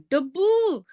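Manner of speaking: "Dabu" high, with a long "oo"; added "oo" ending. Retold or imitated. Correcting the friend's nickname, drawn-out "Dabu". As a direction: swoop up and hold the "oo" of "Dabu". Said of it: "it'll be like".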